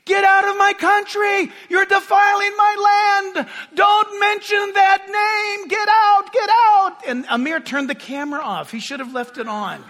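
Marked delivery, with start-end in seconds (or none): 0.0-7.0 high shouted rage, imitating the man; retold screaming. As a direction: yell it like a furious stranger from afar. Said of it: "This guy is screaming".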